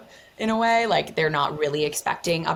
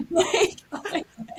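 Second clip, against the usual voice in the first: laughing. Real, not acted. Laughing at the memory.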